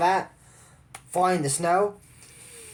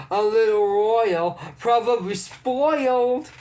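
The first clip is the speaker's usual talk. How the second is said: mock-snooty, higher and slow, drawn out; 4 semitones higher, 4 dB louder, slower than usual. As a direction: sneer it like a haughty trainer teasing a princess. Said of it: "And Sophia goes".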